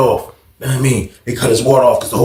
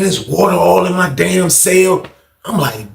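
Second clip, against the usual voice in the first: ordinary delivery, nothing marked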